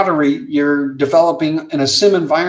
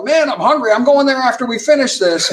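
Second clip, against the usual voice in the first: higher-pitched, animated impersonation; mock eager pilots; 7 semitones higher than usual. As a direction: blurt it like hungry pilots chiming in. Acts out pilots keying up.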